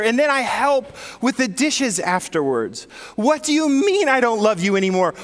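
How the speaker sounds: a husband who feels wronged, pushing back hard; indignant husband. Retold or imitated. Husband protests at counselor.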